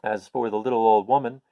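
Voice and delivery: ordinary delivery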